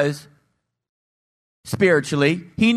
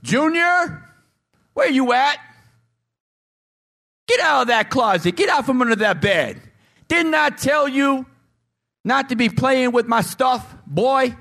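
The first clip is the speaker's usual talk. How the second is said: higher, scolding parent calling; 7 semitones higher than usual. Acted. Parent scolding a child hiding under the bed. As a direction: hunt the kid down and scold. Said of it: "you know when your kids do something wrong".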